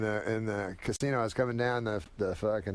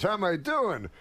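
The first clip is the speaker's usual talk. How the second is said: much higher, brash comic boast; Rodney impression; 8 semitones higher than usual. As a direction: throw the line back like a punchline. Rodney's reply voice.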